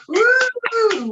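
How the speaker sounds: high, loud cheer of joy; loud whoop. Real, not acted. Guest cheering loudly about her big day. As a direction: let out a happy woo. Excited cheer after laughing.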